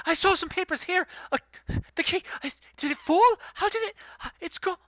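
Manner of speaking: high, flustered and breathless panic; frantic searching. Acted. NPC searching his papers for the key, high flustered panic. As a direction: scramble through the words in a fluster.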